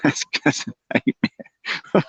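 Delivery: slow, breathy laughing whisper. Real, not acted. Laughing at his own bee nightmare, slow and whispered.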